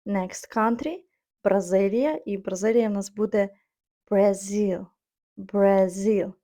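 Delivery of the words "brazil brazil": Brazil as bra-ZIL, weight on the end; stress on second syllable. Shown as a demonstration. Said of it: "Наголос падає на другий склад".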